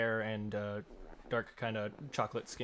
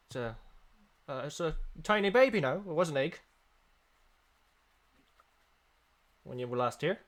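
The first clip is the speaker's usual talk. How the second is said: shopkeeper voice; 4 semitones higher, 3 dB louder than usual. Acted.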